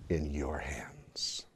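whispered, slow and soft; hushed whisper. Real, not acted. Preacher whispers to the congregation, hushed and slow. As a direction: drop to a whisper on the key line. Preacher drops to make point.

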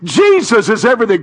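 loud, high, fast preaching call; fired-up proclamation; preaching shout. Real, not acted. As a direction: belt it out to the church. Preacher leads congregation call.

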